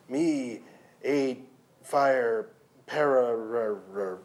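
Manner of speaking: lightly voiced broken bull speech; dazed bull. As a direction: struggle for each word. Bull struggling to remember.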